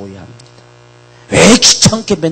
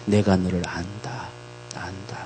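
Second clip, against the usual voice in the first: tender quote; 5 semitones lower, 12 dB quieter than usual. Acted.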